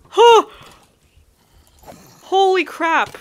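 loud, high gasp then exclaim; shocked gasp. Real, not acted. Sudden surprise in game.

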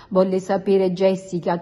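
plain reading voice

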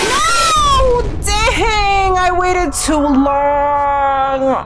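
high wailing dismay, drawn out slowly; anguished cry. Real, not acted. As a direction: wail at losing the run. Dies in the game.

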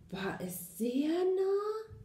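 high, slow, scared mouse; startled. A narrator's reading. Little mouse asking how near it was, startled. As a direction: draw it out in a high frightened question. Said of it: "fragte das Mäuschen erschrocken".

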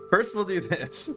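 giggling through the words; laughing. Real, not acted. Streamer setting up the winning combo, laughing. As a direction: barely hold in the laughter.

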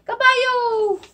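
high, loud answer; excited reveal. Shown as a demonstration. Teacher revealing the horse word, high and loud. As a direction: ring out the answer.

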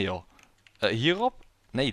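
ordinary delivery

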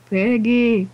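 high, loud call for the pig; sing-song shout to lure the pig. Real, not acted. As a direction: yell out for the piggy. Calling the pig again.

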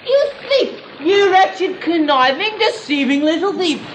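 high, outraged cry of thief; indignant outburst. Acted. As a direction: explode in mock outrage at the swindler. Haggling outburst at drowning seller.

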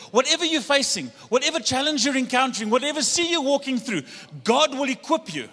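loud, high, rising exhortation; full-force preaching. Real, not acted. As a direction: preach it out to the congregation.

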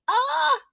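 high sharp scream of fright; startled scream. A narrator's reading. Child frightened by snake in woods, sharp high scream. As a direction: yelp as the snake hisses.

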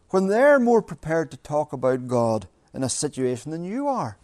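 normal talking voice

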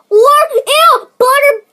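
loud high-pitched disgust; disgusted dread. Real, not acted. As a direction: wail in dread of the flavor. Dreading buttered popcorn flavor.

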